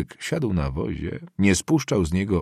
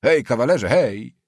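raised, louder hail with authority; hoarse, commanding call; 5 semitones higher, 5 dB louder than usual. A narrator's reading. Nobleman hailing the boy from his carriage, commanding. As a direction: call out imperiously, a little higher. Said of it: "chrapliwy i rozkazujący głos".

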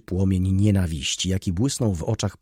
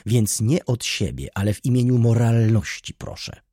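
raised, louder indignant voice; indignant demand; 5 semitones higher, 5 dB louder than usual. A narrator's reading. Baroness demanding the students be removed, indignant. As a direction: plead with theatrical outrage.

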